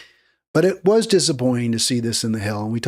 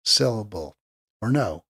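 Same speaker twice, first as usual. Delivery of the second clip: ordinary delivery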